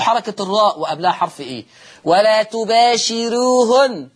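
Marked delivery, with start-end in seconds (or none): 2.0-4.1 ولا تباشروهن slowly, thin ر, the نّ held; light r, slow. Shown as a demonstration. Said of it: "نفس الأمر". (ترقيق الراء ببطء)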